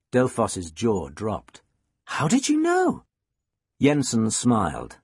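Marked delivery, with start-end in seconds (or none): 2.1-3.0 very high-pitched amazement; astonished. A narrator's reading. Student amazed the teacher read her mind, astonished. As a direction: ask it in high disbelief.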